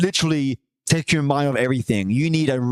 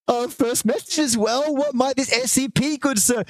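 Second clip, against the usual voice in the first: mock gentleman; 8 semitones higher than usual. Mock formal greeting to viewer.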